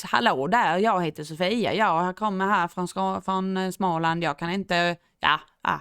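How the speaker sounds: Swedish in an imitated Småland accent; exaggerated sing-song Småland Swedish, faltering at the end. Shown as a demonstration. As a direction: put on a rough attempt at Småland dialect. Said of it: "they talk like this".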